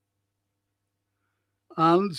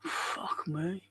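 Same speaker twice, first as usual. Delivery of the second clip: whispered curse, barely voiced; under-breath swearing; 9 semitones lower, 15 dB quieter, much slower than usual. Real, not acted. Streamer curses to himself as something goes wrong, whispered. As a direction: swear softly to yourself in a whisper.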